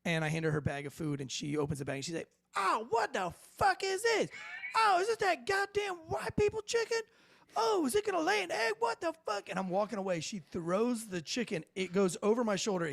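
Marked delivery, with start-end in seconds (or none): 2.6-9.5 very high-pitched imitation of an outraged woman; mock outraged screech. Tarsha opens the bag of wrong chicken, very high mock outrage. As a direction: screech in mock disgust and disbelief.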